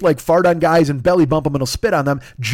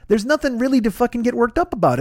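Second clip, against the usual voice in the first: usual speaking voice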